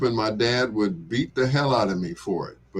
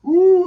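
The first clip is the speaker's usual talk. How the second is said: high, loud startled ooh; shocked squeal; 8 dB louder than usual. Retold or imitated. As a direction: squeal like you just saw trouble coming. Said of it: "I was like".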